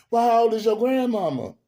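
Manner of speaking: high question that drops at the end; Madea questioning. Retold or imitated. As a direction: act out Madea digging further. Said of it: "when she asked the little girl".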